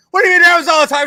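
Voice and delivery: high, loud shocked question; shocked disbelief. Retold or imitated. To girlfriend after the painful squeeze, shocked high disbelief. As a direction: squeak it out in horrified disbelief.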